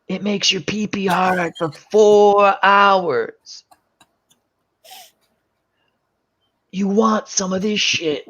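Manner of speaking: slow, drawn-out salesman voice; lightly voiced sleazy dealer; sly dealer pitch. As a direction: sell it slowly and slyly. Dealer pitching the blue pill.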